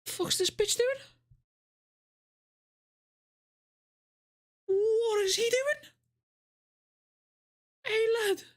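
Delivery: high, slow, stretched-out disbelief; incredulous. Real, not acted. Shocked reaction to the clip.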